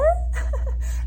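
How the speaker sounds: bright burst of giggling; giggle. Real, not acted. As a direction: giggle at your own slip. Laughs at own stumble.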